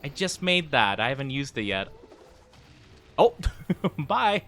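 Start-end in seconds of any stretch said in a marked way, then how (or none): 3.2-4.4 amused goodbye through a laugh. Real, not acted. Player waving off the departing monster, laughing. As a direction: let the word break into laughter.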